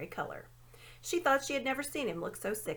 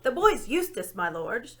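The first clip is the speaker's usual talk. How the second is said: louder owl hoot into a deaf ear; hooted loudly; 2 semitones higher, 7 dB louder than usual. A narrator's reading. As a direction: hoot as loud as you can. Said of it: "hooted the owl as loud as it could".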